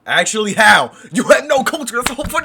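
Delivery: loud demanding shout; shouted disbelief; incredulous shout. Shown as a demonstration. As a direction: yell the question at the screen.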